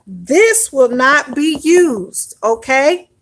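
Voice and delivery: ordinary talk, nothing marked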